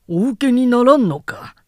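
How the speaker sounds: a startled question from the envoy; surprised envoy. A narrator's reading.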